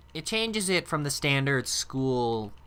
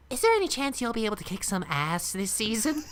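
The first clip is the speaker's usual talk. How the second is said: put-on higher eager young hero voice; a light Jaune Arc voice asking the voice actor a question; Jaune impression; 7 semitones higher than usual. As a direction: ask it like an eager young hunter. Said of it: "my best Sean Arc impersonation".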